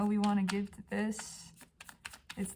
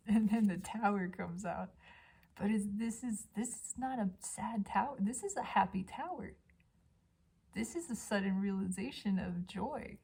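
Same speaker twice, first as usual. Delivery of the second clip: usual speaking voice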